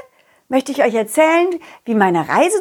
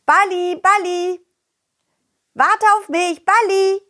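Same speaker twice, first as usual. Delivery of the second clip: calling out; 8 semitones higher than usual. Acted. Calling after running ball.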